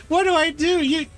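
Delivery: very high, overwrought plea; hammed-up high distressed voice; distraught guilty character. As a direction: wail helplessly in a high character voice. Distraught character at being forgiven.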